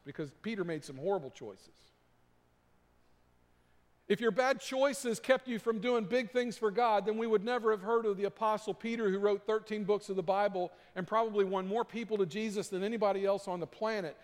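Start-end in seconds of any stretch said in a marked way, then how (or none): 4.1-14.2 higher and louder, driving the point home; preaching at full force. Acted. A pastor urging the congregation that failures don't disqualify, full-force preaching. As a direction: preach it out, building with urgency.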